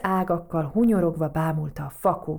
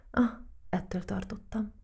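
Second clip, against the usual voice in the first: grumbling; 3 semitones higher, 6 dB quieter than usual. A narrator's reading. Mole grumbling as he leaves his card game, muttered. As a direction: mutter it with a small sigh. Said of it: "morogta vakond".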